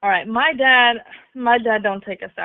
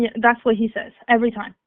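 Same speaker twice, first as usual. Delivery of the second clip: ordinary delivery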